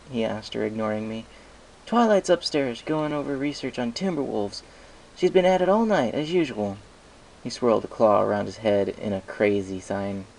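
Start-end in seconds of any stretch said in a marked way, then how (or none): 1.8-6.8 put-on high, louder boyish assistant voice; a kid sidekick rolling his eyes; young dragon Spike. Spike tells Applejack Twilight's been researching all night, high and boyish.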